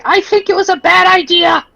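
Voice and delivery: high, squeaky cartoon line; squeaky character voice. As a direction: shout it in a high silly voice. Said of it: "I wanted Kit to just say".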